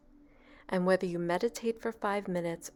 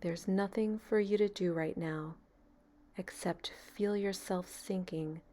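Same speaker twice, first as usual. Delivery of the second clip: calm, even meditation voice with long pauses; guided meditation. Real, not acted. Meditation leader easing a beginner into relaxation, calm and unhurried. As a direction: guide them gently into stillness, leaving space between phrases.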